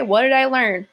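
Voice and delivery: ordinary delivery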